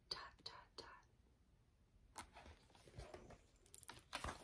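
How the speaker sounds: hushed secret whisper; storybook whisper. Acted. Penny shares a secret with the reader, whispered.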